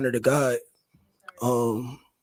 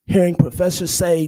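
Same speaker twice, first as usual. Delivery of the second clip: higher, pressing repeat; louder insistent repeat; 4 semitones higher, 4 dB louder than usual. Real, not acted. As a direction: push hearing harder, rising in anger. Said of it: "Hearing professors say that".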